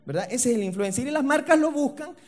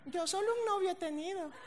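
mock girly voice; 9 semitones higher, 9 dB quieter than usual. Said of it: "todas te dicen la misma paja".

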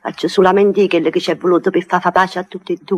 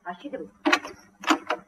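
whisper; 13 dB quieter, much slower than usual. Acted. Mother hissing the lover out.

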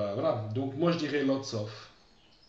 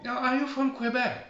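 much higher, delighted guess; surprised question; 12 semitones higher than usual. Retold or imitated. Teacher imitating strangers guessing he's from Quebec, high and surprised. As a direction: pitch it way up like a curious stranger. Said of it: "très souvent".

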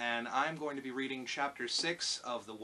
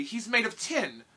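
put-on high, louder girlish voice; indignant girl; 10 semitones higher, 9 dB louder than usual. A narrator's reading. Dorothy explains the Tin Woodman to the lion, high girl's voice. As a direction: state it crossly, pitched up.

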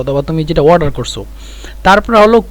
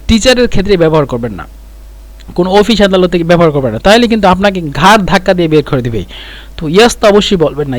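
ordinary delivery

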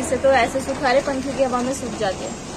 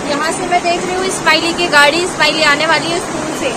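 raised voice, louder and higher, to be heard over the downpour; speaking loudly over rain; 3 semitones higher, 6 dB louder than usual. Real, not acted. Mother vlogging at the window over loud rain, speaking up loudly. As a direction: project the voice over heavy rain outside. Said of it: "ज़ोर ज़ोर से बोल रही हूँ मैं".

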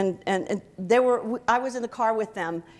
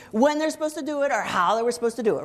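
ordinary talk

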